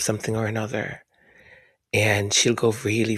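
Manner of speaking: normal talking voice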